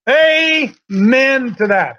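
AMEN stretched long and slow; drawn-out amen. Real, not acted. Preacher calling for agreement on the verse, drawn-out amen. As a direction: call it out slowly, dragging the word.

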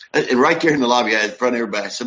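normal talking voice

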